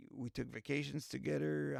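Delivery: ordinary talk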